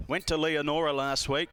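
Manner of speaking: normal talking voice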